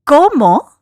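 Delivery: loud shocked yell in Spanish; shocked scream. Real, not acted. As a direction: scream it in pure disbelief.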